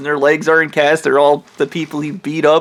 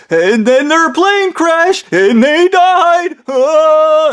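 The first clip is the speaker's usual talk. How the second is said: much higher, slow, mock-tragic wail; hammed-up wailing backstory; 11 semitones higher, slower than usual. Ham Man telling Matt how his parents died. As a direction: overplay the tragedy shamelessly.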